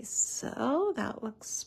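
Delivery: soft, slow whispered check; slow whisper to self. Real, not acted. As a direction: whisper it slowly as you line it up. Checking alignment, talking to self.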